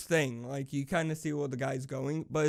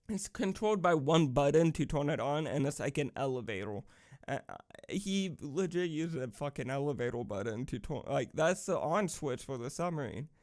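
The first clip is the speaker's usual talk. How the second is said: normal talking voice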